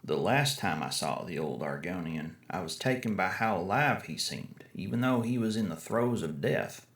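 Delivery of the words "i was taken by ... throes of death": plain reading voice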